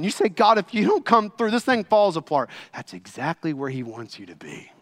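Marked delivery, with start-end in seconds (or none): 0.3-2.5 raised, urgent appeal to God; desperate plea. Acted. Believer at the end of hope calling on God, desperate plea. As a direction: plead as if all depends on it. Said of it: "when you get to the point of desperation".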